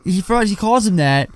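ordinary talk, nothing marked

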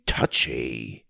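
low falling grumbled touchy; dopey cartoon bellow. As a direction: drop it low, sulky. Repeated falling.

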